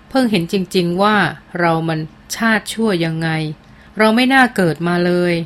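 plain reading voice

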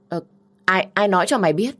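startled defensive sister; flustered sister caught out. Acted. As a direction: caught in the lie.

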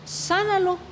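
retold prayer. Teenager praying over a sick man, retold high-pitched plea. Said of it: "y le digo: "Dios".

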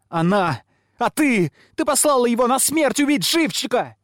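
put-on high, loud girlish tirade; shrill, outraged child accusing everyone; furious tirade. A narrator's reading. Girl's screamed tirade of accusations.

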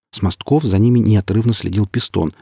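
usual speaking voice

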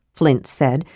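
ordinary delivery, nothing marked

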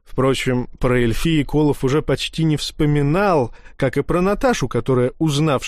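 usual speaking voice

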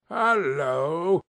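lightly mock-gruff old man; a hint of a put-on grumpy boss; feigned growl. Scrooge feigning gruffness at his late clerk, mock growl. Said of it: "growled Scrooge in his accustomed voice".

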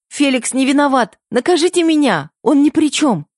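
blurted out. A narrator's reading. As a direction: blurt it out to save her brother. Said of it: "выпалила я".

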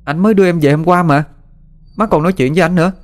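put-on high child's voice in disbelief; high, incredulous questioning; young sibling, disbelieving. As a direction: protest the news in a high voice.

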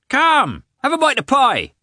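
very high, loud hail; calling out. A narrator's reading. Peasant calls Lievin over for pie, very high loud hail. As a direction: holler the offer of pie.

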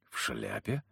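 low, hushed questioning echo; wary question. A narrator's reading. Hunter checks the shopkeeper's description, warily. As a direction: echo it quietly, on guard. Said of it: "насторожился я".

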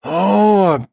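high, gleeful realisation; delighted. A narrator's reading. Baranov on hearing the hero is a bachelor, delighted. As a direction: pounce on the discovery with joy. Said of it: "обрадовался Баранов".

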